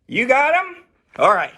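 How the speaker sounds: normal talking voice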